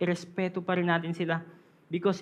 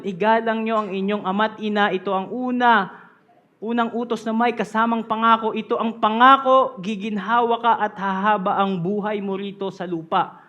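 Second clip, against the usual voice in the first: louder proclaimed Tagalog verse; scripture reading; 5 dB louder than usual. Acted. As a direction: read the promise with weight. Reads Filipino verse.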